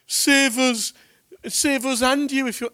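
high, loud scornful taunt; mocking jeer. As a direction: sneer the words as a dying scoffer. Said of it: "That's just mocking".

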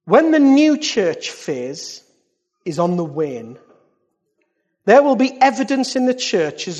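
loud, weighty proclamation; prophetic declamation. Real, not acted. Preacher reads an old prophecy to the church, prophetic declamation. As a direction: proclaim each phrase, pausing between them.

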